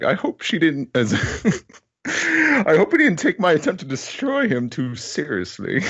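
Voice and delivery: high voice breaking into laughter; giggling lady. Acted. Giggling character line.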